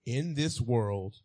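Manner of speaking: In this world said slowly as he starts the verse; slow first read. Shown as a demonstration. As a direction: draw out In this world. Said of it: "Is that what it says?".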